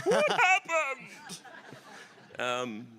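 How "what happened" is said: high, slow, loud comic shriek of a lady; drawn-out high squeal of disbelief. Retold or imitated. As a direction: mimic a shocked theatre-goer at full pitch. Quoting the lady at the play.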